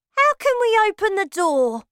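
put-on high, loud boyish question; Bruno's voice. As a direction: toss the question back. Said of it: "Bruno said".

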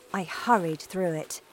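ordinary delivery

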